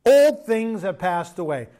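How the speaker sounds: proclaimed scripture. Shown as a demonstration. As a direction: declare it slowly like a verse from the pulpit. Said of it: "Can I just say this to you guys?".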